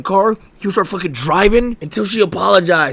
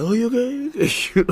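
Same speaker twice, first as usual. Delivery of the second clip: careless mock concern; 5 semitones higher, 6 dB quieter, much slower than usual. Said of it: "dropping my girlfriend and be like".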